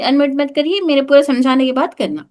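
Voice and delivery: normal talking voice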